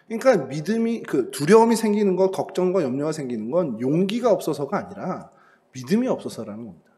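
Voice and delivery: ordinary talk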